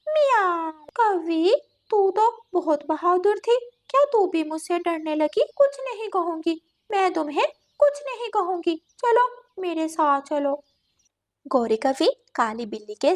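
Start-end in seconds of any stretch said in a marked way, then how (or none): 0.1-0.7 high imitated cat meow. Cat lures crow indoors, high meow. 0.9-10.6 lightly voiced cat, slightly raised pitch; a touch of false reassurance, as a cat; coaxing cat. Cat reassures frightened crow, lightly coaxing.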